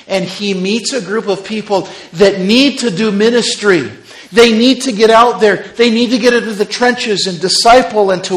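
loud, high, driving preacher voice; full-force preaching. Acted. Pastor rousing his church with a loud sermon. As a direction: thunder it from the pulpit.

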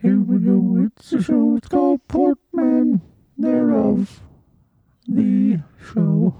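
high, thin mock cheering; drawn-out wavering little cheers; strained high voice. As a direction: cheer feebly through a hurting throat. Feeble cheering after throat hurts.

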